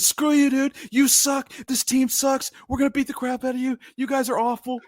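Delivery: high-pitched heated trash talk, half laughing; angry trash talk. Retold or imitated. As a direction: fire off insults in a high, keyed-up voice. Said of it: "I randomly started swinging".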